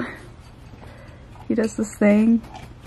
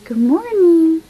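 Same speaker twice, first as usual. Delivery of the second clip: sing-song greeting; 7 semitones higher, 6 dB louder, slower than usual. Real, not acted. Greeting the sleepy boyfriend.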